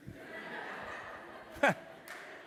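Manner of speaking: a real burst of breathy laughter; laughing. Real, not acted. Laughing at his dad's reply to the congregation. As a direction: laugh high and airy at the punchline.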